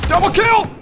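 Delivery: very high, loud cheer; real exultant shout; triumphant scream. Real, not acted. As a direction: announce the kill in wild excitement. Celebrates double kill.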